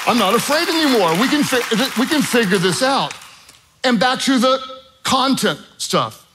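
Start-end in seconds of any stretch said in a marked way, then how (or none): 0.1-3.1 high-pitched, eager reassurance; newfound confidence. Retold or imitated. Student pilot given his instructor's peace, eagerly confident. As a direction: rattle it off high, full of confidence.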